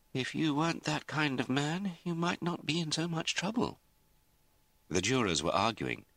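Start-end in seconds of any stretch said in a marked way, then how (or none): 0.1-3.8 high-pitched, mildly sad lawyer; a touch of sad sympathy, pitched up; gently rueful. A narrator's reading. Lawyer consoles his stubborn client, gently rueful. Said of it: "Gordonson smiled sadly".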